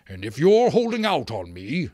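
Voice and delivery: loud, threatening mayor; angry shout. A narrator's reading. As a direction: shout a warning at the outlaw. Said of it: "he shouted".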